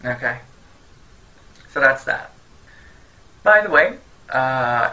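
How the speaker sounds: usual speaking voice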